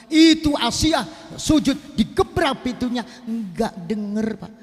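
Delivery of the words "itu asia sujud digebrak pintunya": slow, higher, raised voice for the door slam; dramatic narration. A narrator's reading. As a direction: call it out slowly like a shout. Door slammed during prostration.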